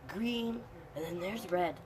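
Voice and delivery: ordinary delivery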